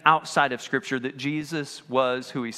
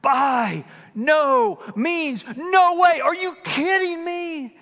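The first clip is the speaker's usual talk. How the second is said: higher, louder drawn-out outburst; Paul's outraged refusal, higher and louder; passionate shout; 10 semitones higher, 6 dB louder, much slower than usual. Retold or imitated. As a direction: hurl the refusal out in disbelief. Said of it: "Paul is really passionate".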